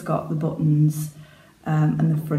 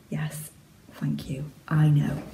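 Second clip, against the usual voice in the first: whispered mock modesty; slower than usual. Real, not acted. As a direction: whisper it smugly. Mock acceptance of praise.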